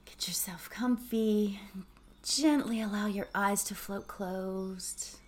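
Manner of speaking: slow whispered meditation voice; soft whispered relaxation cue; guided meditation. Acted. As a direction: hush them gently into stillness.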